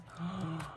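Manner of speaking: soft, high gasp of awe; delighted gasp. Real, not acted. Reacting to photo book pictures.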